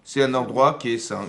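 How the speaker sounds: loud, high, slowed recitation of scripture; a touch of chant, declaiming to the congregation; declaimed verse. Acted. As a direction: intone the holy text over the music. Declaims verse over music.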